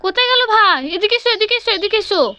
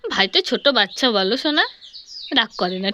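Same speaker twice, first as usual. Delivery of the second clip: 1 soothing; 6 semitones lower, 4 dB quieter than usual. Acted. Mother soothes daughter.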